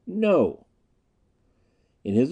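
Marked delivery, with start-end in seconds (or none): 0.1-0.7 defiant. A narrator's reading. Captive refusing under torture.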